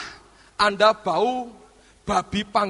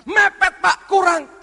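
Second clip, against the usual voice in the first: whiny complaint; 8 semitones higher, 6 dB louder, slower than usual. Retold or imitated. Congregation's mock complaint.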